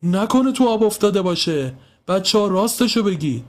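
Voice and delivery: high, anxious questioning; alarmed man urging them to tell the truth; worried. A narrator's reading. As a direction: press the children, fearing the worst. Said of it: "نگاهی نگران به استخ انداخت".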